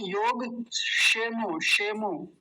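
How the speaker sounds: योगक्षेमो as yog-shemo, without the k; mistaken pronunciation. Shown as a demonstration. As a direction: drop the क of क्ष in योगक्षेमो. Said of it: "कुछ लोग बोलते हैं". (योगक्षेमो में क छोड़कर, शेमो जैसा)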